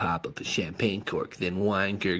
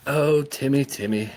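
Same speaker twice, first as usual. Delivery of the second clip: put-on gurgling drunk woman; 7 semitones higher, 4 dB louder than usual. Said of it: "gurgled Jetta".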